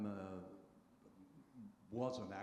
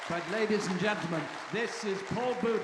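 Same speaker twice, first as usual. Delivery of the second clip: rousing announcement; 9 semitones higher, 14 dB louder than usual. Real, not acted. Producer introducing speaker to audience.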